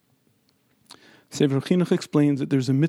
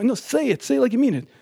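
high-pitched, insistent urging; urgent coaxing; 9 semitones higher, faster than usual. Retold or imitated. Rabbi pressing thirty men in a classroom, urgent coaxing. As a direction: push them to mean it, voice lifted high.

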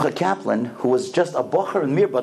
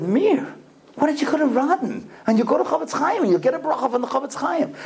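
much higher, astonished then insistent; urgent retold advice; 9 semitones higher than usual. Stranger telling the crying mother to go to Radin, urgent. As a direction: imitate a stranger eagerly pressing advice.

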